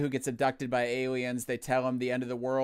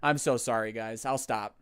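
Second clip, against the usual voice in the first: ordinary delivery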